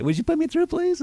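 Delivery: very high, squeaky pleading "please"; mock a timid salesperson, way up high; weak dumpling voice. Shown as a demonstration. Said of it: "turn into weak dumplings".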